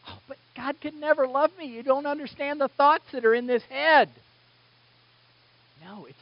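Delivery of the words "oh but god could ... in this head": whiny doubter. Retold or imitated. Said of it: "we go".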